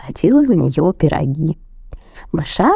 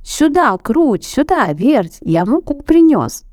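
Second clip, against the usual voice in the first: high calling voice; rooster calling; 9 semitones higher than usual. A narrator's reading. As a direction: call the mice over. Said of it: "зовёт мышат".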